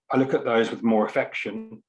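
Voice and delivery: ordinary delivery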